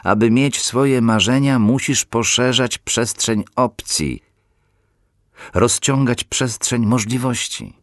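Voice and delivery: "Aby mieć swoje marzenia" pushed up to the peak of the build, leaning on "swoje"; "Aby mieć swoje marzenia" as the final rising cry, "swoje" stressed; climactic refrain. Shown as a demonstration.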